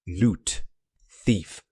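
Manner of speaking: lightly voiced robot accusing a thief; hint of a machine, one word at a time; mechanical voice. A narrator's reading. Robot names the thief to its king, lightly mechanical. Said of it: "Yappy mechanical voice".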